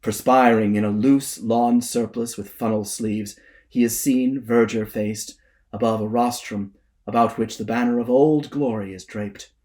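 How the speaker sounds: ordinary delivery, nothing marked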